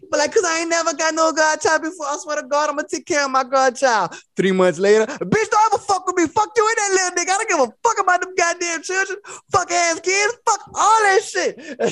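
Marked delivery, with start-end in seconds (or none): 0.3-4.1 eager promise. Retold or imitated. Eager would-be godparent. 5.1-11.6 high, cursing tirade; furious rant. Retold or imitated. Bad godparent cursing the mother out, high and furious.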